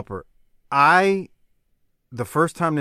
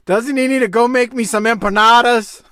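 mock heel taunt; 9 semitones higher, 9 dB louder than usual. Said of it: "And Jaworski goes".

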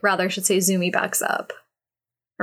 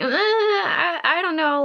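flustered; 10 semitones higher than usual. Retold or imitated. Flustered man refusing her.

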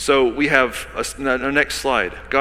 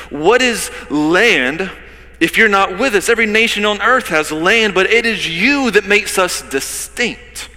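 impassioned plea; 7 semitones higher, 6 dB louder than usual. Acted. Preacher pleading with God.

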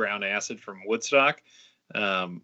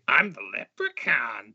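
imitated leprechaun. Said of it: "go around and say".

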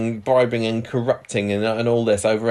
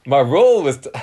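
a louder attempt at a Geordie accent; 4 semitones higher, 6 dB louder than usual. Shown as a demonstration. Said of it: "test out my Geordie accent".

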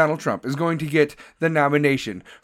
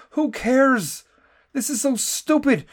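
exasperated outburst; 7 semitones higher than usual. Real, not acted. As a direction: throw it out, exasperated. Repeated dismissive line.

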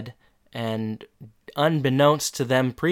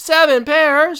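high, loud disbelief; over-the-top infomercial surprise; mock amazed customer; 11 dB louder, slower than usual. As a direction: shriek it like an ad's astonished shopper. Second voice in parody advert.

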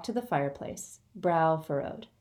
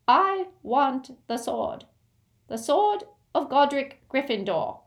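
demanding goblin; 8 semitones higher, 4 dB louder than usual. Acted. Goblin demands his price.

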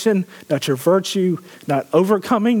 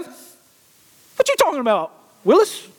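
comic catchphrase; 9 semitones higher, slower than usual. Pretend. Joke quote as a TV character.

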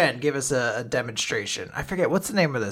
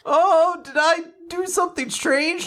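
high squeaky Fuko; 13 semitones higher than usual. Retold or imitated. Retelling the show, Fuko voiced high and worried. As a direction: squeak it out like a nervous oddball.

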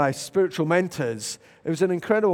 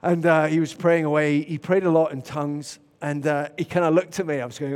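ordinary delivery

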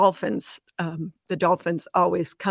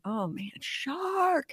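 high, soft, stretched-out mock call; small, thin, drawn-out alarm, re-enacted; stifled warning call; 7 semitones higher, 8 dB quieter, much slower than usual. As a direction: try to call out without being noticed. Warning husband while avoiding sharks' notice.

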